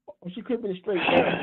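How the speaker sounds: normal talking voice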